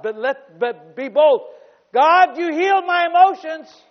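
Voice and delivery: high, loud preacher's cry; full-force preaching. Real, not acted. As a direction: drive it out boldly to the congregation.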